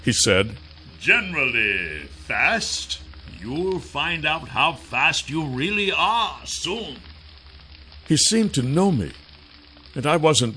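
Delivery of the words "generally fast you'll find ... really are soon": slow, softer sneering villain; quiet menacing villain. Acted. Kryzak threat.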